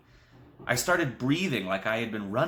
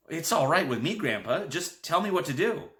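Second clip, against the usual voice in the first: blurted; 7 semitones higher than usual. A narrator's reading. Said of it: "I reared back and blurted".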